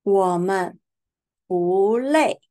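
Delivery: 不累 with 不 in rising second tone, clear and careful; bu lei demo. Shown as a demonstration. Teacher modelling 不累 for students, clear second-tone 不. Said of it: "Here we pronounce 不累".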